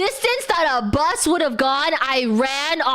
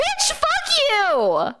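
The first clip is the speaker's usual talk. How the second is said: mock outrage; 13 semitones higher, much slower than usual. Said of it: "I'm like".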